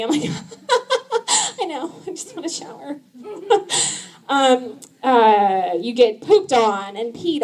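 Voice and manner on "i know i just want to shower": weary sigh. Retold or imitated. Weary reply to husband.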